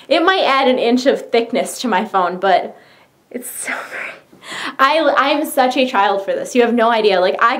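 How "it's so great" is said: hushed, voiceless whisper; awed whisper. Real, not acted. Gushing over her phone case, awed whisper. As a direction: whisper it as if overwhelmed. Gushes under her breath.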